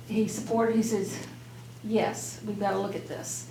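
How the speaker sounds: usual speaking voice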